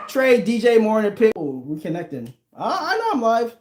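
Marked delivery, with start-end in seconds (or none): none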